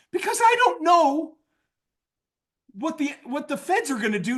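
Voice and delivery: high-pitched, hammed-up alarm; exasperated salesman pitching up high; mock urgency.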